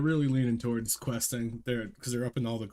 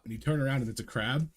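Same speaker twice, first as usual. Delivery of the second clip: ordinary delivery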